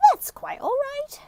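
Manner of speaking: the man. Acted. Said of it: "said the man".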